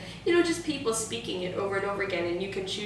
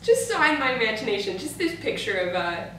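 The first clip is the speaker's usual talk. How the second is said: normal talking voice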